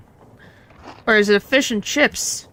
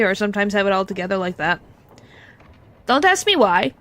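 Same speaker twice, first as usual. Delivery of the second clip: ordinary talk, nothing marked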